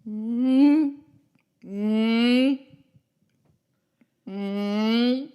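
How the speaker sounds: loud humming frog croak. Pretend. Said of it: "Can you sound like that frog?".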